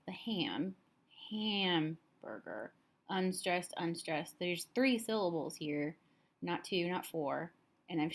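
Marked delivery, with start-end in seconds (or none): 1.3-2.7 hamburger slowly split, long HAM then quick burger; slow syllable demo of hamburger, weight on HAM; stress on first syllable. Shown as a demonstration. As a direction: stretch out HAM, pause, drop burger. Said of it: "the stress is on this first syllable".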